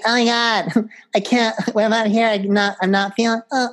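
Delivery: high camp voice; high, hammed-up camp whine; mock camp whine. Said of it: "It wouldn't be like".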